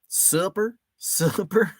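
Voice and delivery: Southern accent. Shown as a demonstration. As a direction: try it with a hint of a Southern drawl. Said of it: "try to say it with a Southern accent".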